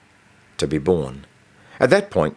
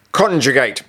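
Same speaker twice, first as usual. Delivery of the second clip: sharp, higher commanding order; stern teacher command; 6 semitones higher, 4 dB louder than usual. Acted. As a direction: bark it like a strict teacher. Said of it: "she said".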